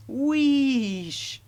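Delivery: high, loud steam whoosh; Percy startling Henry with a wheesh; sudden loud wheesh. A narrator's reading. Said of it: "said Percy suddenly".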